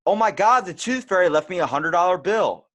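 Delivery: high, loud thrilled squeal; a young boy overjoyed at the Tooth Fairy's gift; excited kid. Retold or imitated. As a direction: shriek it like a kid finding cash. Child finding money, excited.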